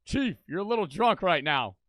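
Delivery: put-on loud, teasing scolding of a drunk boss; mocking cop talking down. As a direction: talk down to him like a cop. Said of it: "I'd be like".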